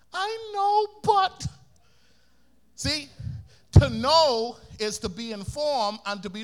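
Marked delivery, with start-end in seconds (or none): 0.1-1.5 put-on high, far-off kid's excuse; a kid trying to wriggle out; sheepish child. Child answering mother.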